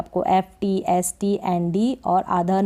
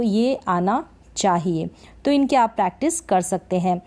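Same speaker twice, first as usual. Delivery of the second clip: ordinary talk, nothing marked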